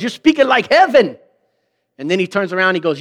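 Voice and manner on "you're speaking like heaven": praising Peter. Retold or imitated.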